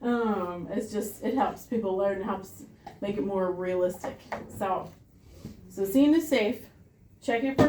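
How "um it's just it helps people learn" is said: ordinary delivery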